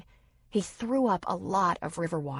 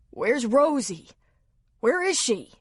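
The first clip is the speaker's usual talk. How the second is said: panicked asking; 6 semitones higher, 6 dB louder than usual. A narrator's reading. Panicked man after near drowning.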